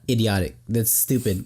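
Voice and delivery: ordinary talk, nothing marked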